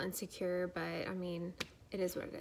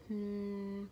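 humming buzz. Shown as a demonstration. Pregnant vlogger demonstrating her pelvic vibrations, humming. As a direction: imitate a buzzing vibration with a hum. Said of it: "humming or buzzing".

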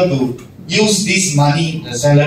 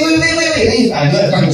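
much higher, urgent pretend rush; mock hurry; 10 semitones higher than usual. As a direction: wave them off as if late. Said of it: "You say".

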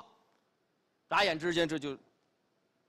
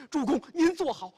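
urgent call; 7 semitones higher, 7 dB louder than usual. Acted. Qinzi catches lord on chariot.

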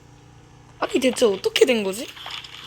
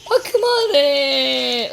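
slow, loud, wailing stop it; crying shout; 4 semitones higher, 4 dB louder, much slower than usual. Real, not acted. Gamer begging the attackers to stop, loud wailing plea. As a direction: wail it out in mock despair. Overrun, giving up.

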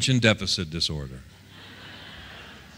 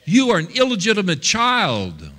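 high-pitched mock accusation; accusing voices; 6 semitones higher than usual. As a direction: taunt him as his accusers, pitched up. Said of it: "the voices that were coming at him".

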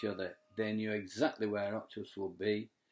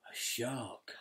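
softer, hushed and breathless; gasped whisper; 5 dB quieter than usual. Acted. Reading to the children, a hushed gasp at the shark. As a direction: gasp it out as if spotting danger.

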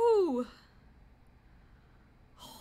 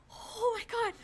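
gasp, then a breathless oh my god; frightened gasp of shock; real jump-scare gasp; startled gasp; 6 semitones higher, 3 dB louder, much slower than usual. Real, not acted. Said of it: "I hated that so much".

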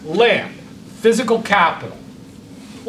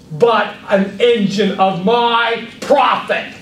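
slow, pointed emphasis at a higher pitch; higher, slower and pressing; emphatic, rising; 4 semitones higher, slower than usual. Shown as a demonstration. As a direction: drive each phrase home to the class. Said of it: "Do you hear what I did".

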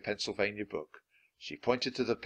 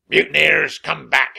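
loud, higher, furious call; captain's shout; 5 semitones higher, 12 dB louder than usual. A narrator's reading. Captain calls back his running men, shouting angrily. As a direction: roar after a fleeing crew. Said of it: "shouted Captain Bones".

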